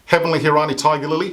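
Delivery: ordinary talk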